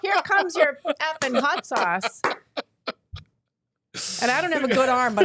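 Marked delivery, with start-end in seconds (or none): 0.0-2.2 hammed-up, drawn-out sassy call; mock waitress holler. Waitress hucking hot sauce across the room, mock holler. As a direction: play at hollering an order across the room, high-pitched.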